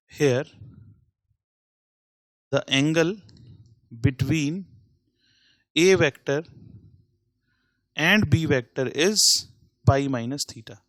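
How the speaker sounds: very slow, spaced-out board dictation. Shown as a demonstration. Teacher dictating a note to students, slow and spaced. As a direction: dictate word by word as you write.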